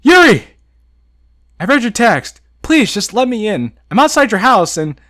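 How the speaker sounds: loud, high, frantic plea down the phone; frantic pleading into a phone; panicked pleading. Acted. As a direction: beg her to open up, desperate. Panicked call to Yuri on phone.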